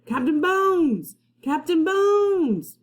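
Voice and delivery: high, loud, slow chanted call; a crew of pirates yelling the captain's name; pirates' cry. Acted. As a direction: pirates calling out for their captain. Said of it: "The pirates took up the cry".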